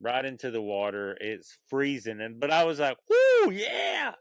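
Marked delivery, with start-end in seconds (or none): 3.1-4.2 high, loud hyped-up shout; excited whoop. Retold or imitated. Swimmer psyching himself up in the freezing water, excited whoop. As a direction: whoop like you're charging in. Hyping self up at swim start.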